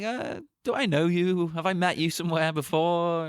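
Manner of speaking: lightly acted vague recognition, questioning; imagined Michael Keaton. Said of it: "He's like".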